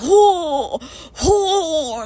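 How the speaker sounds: nauseated high gagging cries; retching groans. Retold or imitated. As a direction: let the oh's come out like a dry heave. Dry heaving at the smell.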